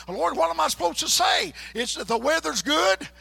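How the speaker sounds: loud, high plea to the Lord; crying out to God. Retold or imitated. Preacher retelling his panicked prayer, loud cry. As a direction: call out to God in desperation. Desperate prayer retold aloud.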